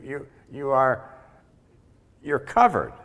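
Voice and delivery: emphatic preaching. Acted. Preacher stressing assurance.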